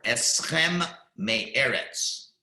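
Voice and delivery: very slow "Eschem me'eretz" with the M held; deliberate example reading; slow and careful. Shown as a demonstration. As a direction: stretch "Eschem" so it doesn't merge with "me'eretz". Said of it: "those are the examples".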